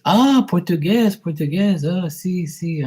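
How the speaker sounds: high-pitched saleslady catching on; mock realization.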